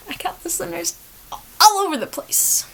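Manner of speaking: high-pitched, faltering insistence; unconvinced boast. Real, not acted. Insisting to listeners they are everywhere, high-pitched protest.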